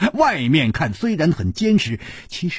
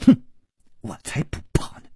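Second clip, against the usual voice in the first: scornful whisper; faster than usual. Acted. Scornful brush-off.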